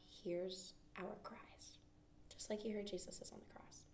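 whisper. Real, not acted. Soft aside about Jesus's cries.

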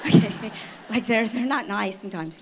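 laughing it out, voice pushed up loud; real laughter breaking through the joke; giggling protest to God. Real, not acted. Joking to God about armed kids.

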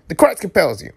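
high, falling exorcist cry; mock exorcism. Reader warding off Red Devils on screen, mock exorcism.

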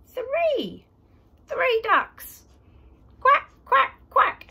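high loud excited shout. Acted. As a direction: burst out on the last count. Excited counting climax.

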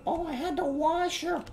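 very high falsetto mom impression; put-on high-pitched mommy voice, dropping at the end; mock mom voice. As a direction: squeak it out like a hammed-up mother. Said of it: "My mom be washing babies in there".

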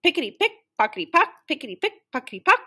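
quick, high rhythmic clatter; high rhythmic train-wheel patter. A narrator's reading. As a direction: chant it fast like wheels on rails. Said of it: "The wheels clattered over the rails".

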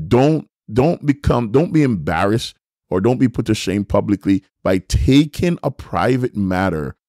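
usual speaking voice

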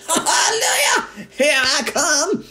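put-on a wild old witch crowing with glee; screechy old grandma shout. A narrator's reading. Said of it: "she shouted".

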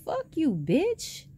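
imitating a heated curse-out; retold comeback. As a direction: fire it back at her, drawn out. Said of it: "And I was like".

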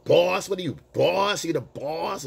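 loud challenge. Retold or imitated. Woman confronting the man in the boss hat, mock loud challenge. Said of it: "she was saying it so loud".

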